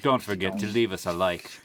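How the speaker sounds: grand host announcing the sign-off; mock-formal announcer wrapping up; show outro. Host signing off the podcast to listeners, announcer style.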